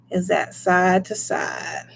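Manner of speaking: hushed, slow whisper. Real, not acted. Whispering to viewers as the pendulum swings. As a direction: whisper it while watching closely.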